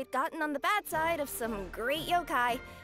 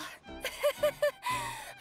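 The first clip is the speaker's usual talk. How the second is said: high, giddy laughter; relieved laugh. Acted. Waking sleeper glad it was a dream, relieved laugh.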